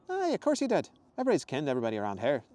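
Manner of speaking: high Scottish woman; quick, high Scottish banter; Scottish accent. Acted. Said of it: "much softer Scottish accent".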